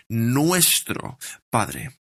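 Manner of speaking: "Nuestro" drawn out very slowly and weighted; slow, heavy stress on "Nuestro". Shown as a demonstration. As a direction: press "Nuestro" to show the plural. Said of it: "Solo quiero que veáis el énfasis".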